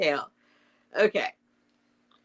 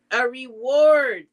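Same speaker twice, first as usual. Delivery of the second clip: slow, raised-pitch proclamation, mildly loud; 6 semitones higher, 6 dB louder, slower than usual. A narrator's reading. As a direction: proclaim "A reward" as Owl, drawn out. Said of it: "said Owl very loudly".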